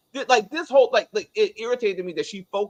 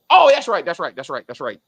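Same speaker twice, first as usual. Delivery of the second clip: sudden louder oh, then fading repeats; hammed-up parent caught forgetting the baby; mock forgetful parent; 2 semitones lower, 7 dB louder than usual. Mocking forgetful parents at counseling.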